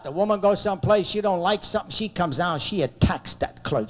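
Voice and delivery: ordinary talk, nothing marked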